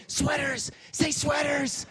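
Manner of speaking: high, loud hissed prompting; shout-whisper the answer at him; urgent shout. Retold or imitated.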